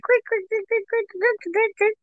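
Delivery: rapid high-pitched little crick noises; imitated knee cracking. Friend noisily approaching on cracking knees, high imitated cracks. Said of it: "cracking of the knees".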